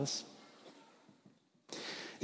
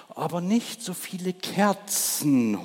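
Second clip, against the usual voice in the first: higher, slow owl hoot; howling owl; 7 semitones higher than usual. Acted. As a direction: stretch it out like an owl. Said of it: "heulte die Eule". (Die Eule heult über zu viele Kerzen)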